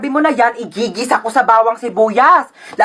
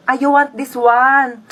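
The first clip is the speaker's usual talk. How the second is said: ordinary delivery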